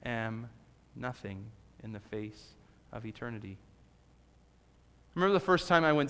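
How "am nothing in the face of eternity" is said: hushed lost feeling. Pretend. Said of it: "that lost feeling of".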